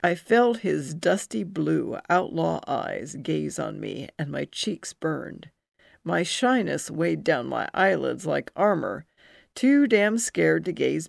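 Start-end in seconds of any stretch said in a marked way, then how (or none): none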